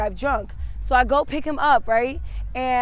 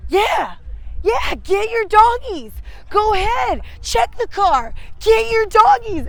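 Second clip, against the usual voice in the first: high, loud, slow taunting of the cop; high mocking shout daring the cops to bring the dogs; mocking drunk friend; 8 semitones higher, 4 dB louder, slower than usual. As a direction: egg the cops on like a careless drunk.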